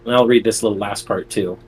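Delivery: usual speaking voice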